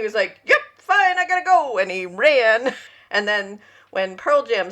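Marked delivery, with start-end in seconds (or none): 0.4-1.8 high, louder quick dismissal; hurried brush-off. Retold or imitated. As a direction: get away fast, brushing it off. [name] brushing her off.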